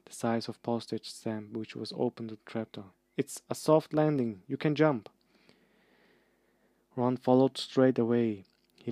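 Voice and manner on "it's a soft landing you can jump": loud, higher call upward. Acted. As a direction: shout it up to a friend. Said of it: "he called up to the light".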